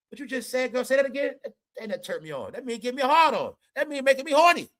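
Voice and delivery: mock flirty coworker. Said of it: "you at your job and the guy says".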